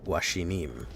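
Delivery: normal talking voice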